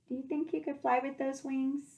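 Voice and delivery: usual speaking voice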